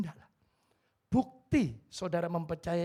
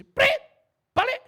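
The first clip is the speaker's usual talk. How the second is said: shrill loud whistle and yell; police shout; 14 dB louder, slower than usual. Retold or imitated. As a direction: blow the whistle and bark the order.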